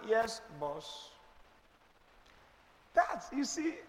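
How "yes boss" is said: a soft, meek "Yes, boss" with a falling end; meek wife. Retold or imitated. Sarah meekly agreeing to follow Abraham, soft and obedient. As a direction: answer like a dutiful wife, quietly. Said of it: "Sarah said".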